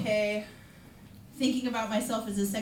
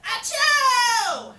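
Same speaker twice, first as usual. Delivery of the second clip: sharp gasp then a loud high achoo; gasp and loud sneeze; 12 semitones higher, 10 dB louder than usual. Retold or imitated. Comic acts out sneezing in her car, loud sneeze. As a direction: fake a big sneeze, gasp first. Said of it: "And I was like".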